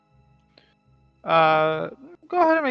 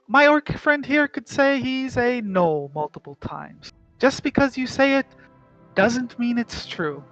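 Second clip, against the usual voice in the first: higher, louder, condescending official; haughty drow voice; 5 semitones higher, 5 dB louder than usual. Acted. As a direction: talk down to them impatiently. Drow official scolding the party.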